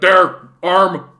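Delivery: loud mock orc bark. Orc medic announcing the hammered-on arm, loud mock shout. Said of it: "And then be like".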